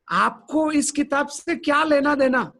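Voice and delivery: high, cheeky challenge; talking back with youthful cheek; mock defiant question; defiant retort. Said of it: "मैंने ऐसे बोला".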